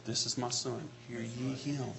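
soft, low, reverent; hushed voice of God. Acted. God speaking to the disciples, hushed and reverent. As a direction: let it fall hushed and awed.

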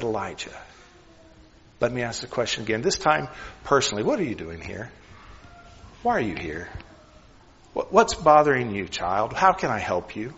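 soft, hushed and gentle, close to the ear; tender whisper. Pretend. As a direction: lean in and ask softly like a caring father. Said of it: "God came down and said".

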